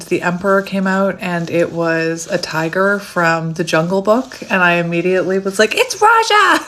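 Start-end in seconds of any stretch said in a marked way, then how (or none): 5.8-6.6 high-pitched, loud burst of delight; thrilled, high exclamation; excited squeal. Retold or imitated. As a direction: squeal it like spotting an old favorite. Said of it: "I got so excited".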